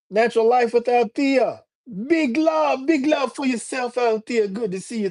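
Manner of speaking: high-pitched, lilting Jamaican greeting; Jamaican accent. Real, not acted. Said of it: "my Jamaican accent coming out".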